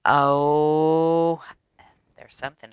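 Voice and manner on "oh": plain reading voice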